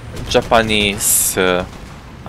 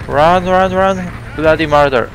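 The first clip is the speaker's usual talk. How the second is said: panicked high yell; fleeing in a panic, shouting; being chased; 7 semitones higher, 4 dB louder than usual. Real, not acted. Enemy IS-6 appears, fleeing.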